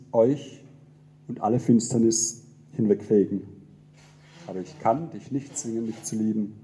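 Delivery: solemn poem reading with swelling refrains; poem recitation. Acted. Pastor reciting a poem as God to the congregation, solemn and swelling. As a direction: recite it as God speaking, rising and falling.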